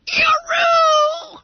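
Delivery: very high, loud yell of pain; pained roar. Acted. Boy swiped by the cane, high loud yell of pain. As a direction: hit by the cane. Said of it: "roared Bunter".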